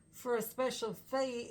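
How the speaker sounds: ordinary talk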